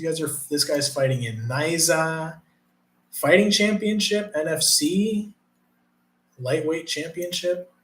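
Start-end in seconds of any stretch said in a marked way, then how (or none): none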